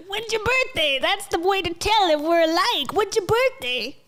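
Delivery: high, put-on mimicking voice; mocking impression. Reactor mocking guests comparing birthdays, high parody voice.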